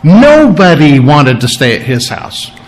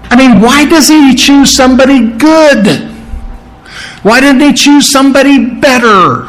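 much higher whiny griping voice; high nasal complaining onlookers; griping crowd; 10 semitones higher than usual. Retold or imitated. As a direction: whine it like a scandalized crowd. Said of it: "it means they were griping".

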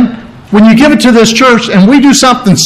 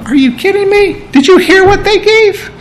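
much higher, incredulous protest; disciples' disbelief; 10 semitones higher than usual. Retold or imitated. Disciples protest to Jesus in a much higher, incredulous voice. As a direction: squeak out stunned disbelief. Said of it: "They're going".

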